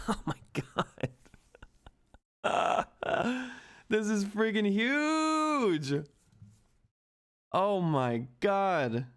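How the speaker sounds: breathless, slow disbelief, laughing; real joy as the stock jumps; stunned delight. Real, not acted. Streamer stunned by the stock surge, breathless delight.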